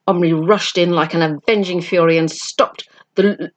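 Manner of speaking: usual speaking voice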